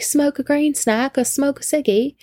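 high-pitched mock British accent; pretend-British question, voice lifted high; put-on British accent. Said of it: "Again, not gonna do that".

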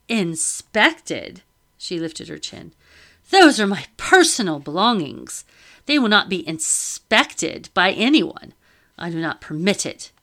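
loud, haughty refusal; indignant lady. Acted. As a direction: refuse it with lifted chin. Madame refuses inspection.

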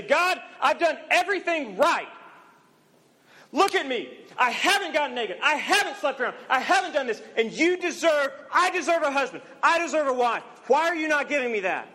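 high, loud indignant demand to God; self-righteous complaint. Acted. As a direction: whine at God like you've earned your reward.

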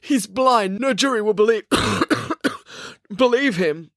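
high protest broken by a coughing fit. Acted. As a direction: cough midway, then push on. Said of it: "coughed Maya".

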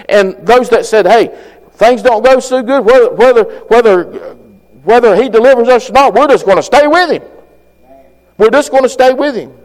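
loud, high preaching at full force. Acted. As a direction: drive it home from the pulpit.

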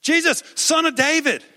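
loud, high-pitched call; shouting out. Acted. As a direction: holler it to someone passing by. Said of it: "And he shouted out to Jesus".

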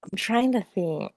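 ordinary talk

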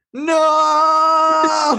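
high, loud cry of dismay; horrified shout. Retold or imitated. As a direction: wail it like your treasure is ruined. Seeing tea stain on chadar.

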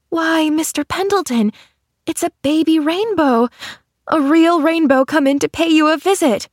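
put-on high-pitched child in awed delight; delighted exclamation. A narrator's reading. Pollyanna spotting a rainbow on the pillow, delighted. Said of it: "she exclaimed".

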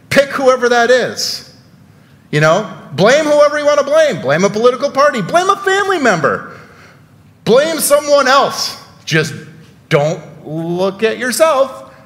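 high-pitched sarcastic encouragement; mock urging. Preacher mockingly urging the congregation to blame others, sarcastic. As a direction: push the pitch way up, egging them on insincerely.

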